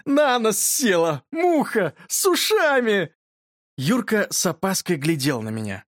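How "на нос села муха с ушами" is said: high, drawn-out exclamations of acted hilarity; gasping with mirth. A narrator's reading. Boy teases Yurka about the fly, helpless with mirth. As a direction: squeal each phrase slowly, overcome by amusement. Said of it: "задыхался я".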